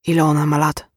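croaked question; hoarse. A narrator's reading. Man with suddenly dry throat asks her age, hoarse. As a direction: force it out through a dry throat. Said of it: "wychrypiał".

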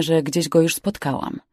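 ordinary delivery, nothing marked

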